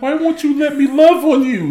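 high whiny plea; mimicking wife. Husband voices wife, pitch up.

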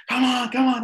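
mock scream of encouragement; retold screaming cheer. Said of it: "I'm, I'm screaming".

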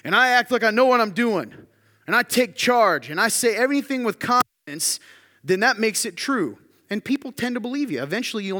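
high, loud, over-assured declaration; acted confidence. Preacher acting out someone faking it through life, loudly over-confident. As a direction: take charge with pumped-up, emphatic confidence. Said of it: "if I act really, really confident".

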